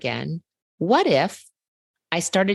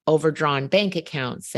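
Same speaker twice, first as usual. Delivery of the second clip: ordinary delivery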